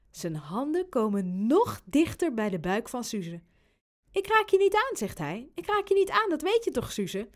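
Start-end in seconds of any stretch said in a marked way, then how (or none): 4.1-7.3 high, innocent promise; teasing reassurance. Acted. Robin promises baby Suze no touching, high teasing reassurance. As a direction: pitch it high, dropping on the name.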